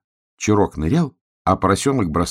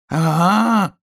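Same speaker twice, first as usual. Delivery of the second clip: shout; 10 semitones higher, 5 dB louder than usual. Acted. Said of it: "крикнул поросенок".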